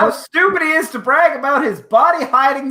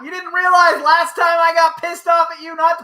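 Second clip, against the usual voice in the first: higher, sharper mock rant; angry yell re-enacted for the story; retold angry outburst; 7 semitones higher than usual. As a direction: relive losing it at a friend. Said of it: "I lose my fucking shit".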